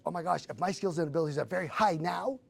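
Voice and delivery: rising excitement at a discovery; excited realization. Retold or imitated. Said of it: "And I'm like".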